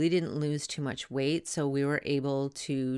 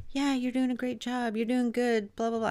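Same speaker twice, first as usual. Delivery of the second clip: lightly imitated upbeat cheering, higher; a hint of a chirpy cheerleader husband; mock cheering partner; 9 semitones higher than usual. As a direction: parrot his eager encouragement. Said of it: "cheering me on, like".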